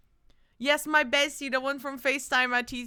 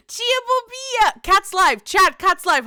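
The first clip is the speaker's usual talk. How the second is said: excited shout; 8 semitones higher, 10 dB louder than usual. Real, not acted.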